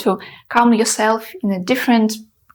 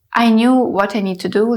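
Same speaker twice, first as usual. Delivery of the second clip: ordinary talk, nothing marked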